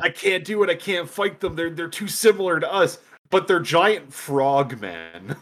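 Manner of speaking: high hammed-up distressed soldier; high mock anguish. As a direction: play the torn soldier high and dramatic. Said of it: "it's like".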